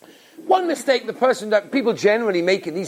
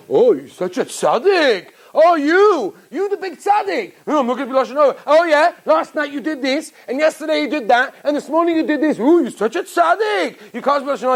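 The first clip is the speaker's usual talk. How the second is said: mocking Satan voice; 6 semitones higher than usual. Satan taunting the listener about being a tzaddik, sneering. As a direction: needle him like a mocking tempter. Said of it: "he'll tell you is one say".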